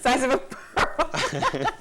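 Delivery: high, slow, dissolving into laughter; cracking up at the joke; laughing. Real, not acted. Reporter laughing at host's pearl callback, high and slow.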